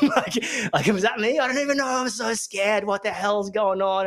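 high, laughing, half-scared giggle; giggly mock fright; laughing mock fear. Real, not acted. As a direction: crack up at yourself, then play scared. Laughing at his own cult-member voice.

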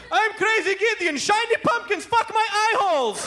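a TV salesman going mad; unhinged pitchman. Pretend. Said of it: "imagine the commercial degenerates".

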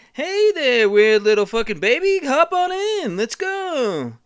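high, loud goofy cartoon voice; goofy Pixar guy. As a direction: ham it up like a dopey sidekick. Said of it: "the guy that's always in all the Pixar movies".

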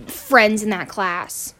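ordinary delivery

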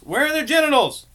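high, loud disbelief; incredulous. Real, not acted. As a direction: shout the question in disbelief. Incredulous outburst.

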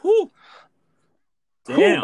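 high, loud impressed whoop. Real, not acted. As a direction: let out an impressed whew. Reacting to rapid list.

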